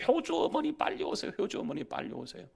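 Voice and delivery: lightly acted announcer call, starting high; PA announcement imitation. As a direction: imitate a hospital page calling a parent. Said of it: "방송이 나오는 거예요".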